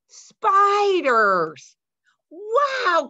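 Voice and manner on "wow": high, loud breathless wow; gasped wow. A narrator's reading. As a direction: gasp at the spiders in wonder. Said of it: "Clyde gasped".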